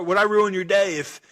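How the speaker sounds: mock Australian caller. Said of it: "a little Australian accent".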